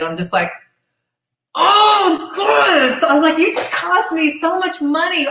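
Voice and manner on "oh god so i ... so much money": high, loud, drawn-out wail of exasperation; frustrated outburst. Retold or imitated. Wrestler told her match is off, exasperated. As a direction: re-enact an exasperated blow-up over lost pay. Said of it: "I shared a little bit of the frustration".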